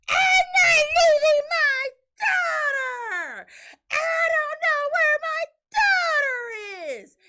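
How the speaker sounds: high, long, loud mock wail; screaming. Wife mimicking husband refusing to leave his daughter, screaming. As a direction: holler it like a frantic parent, dragging out each word. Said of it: "he's sitting there still screaming".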